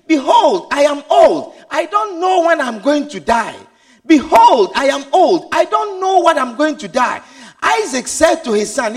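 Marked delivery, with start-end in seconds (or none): 0.1-7.2 loud, pleading cry of an aged father; old father crying out. Acted. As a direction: shout it like a father begging his children. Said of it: "They're saying".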